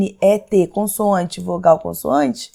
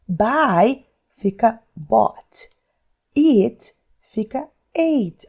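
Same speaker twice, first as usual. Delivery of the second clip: Buy fica bought said very slowly; slow demonstration; much slower than usual. Shown as a demonstration. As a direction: drag each verb pair out for the class. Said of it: "olha só que diferente". (bem devagar, mostrando cada verbo)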